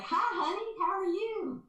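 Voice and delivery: high, sweet Southern greeting; Southern accent. Retold or imitated. Godfather greeting her in a high Southern accent. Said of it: "the intonation, that, the Southern accent".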